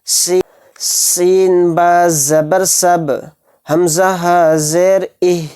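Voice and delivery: slow, steady syllable spelling; qaida drill reading. Acted. Teacher drills qalqala syllables, slow steady reading. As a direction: read each spelling slowly for students to repeat.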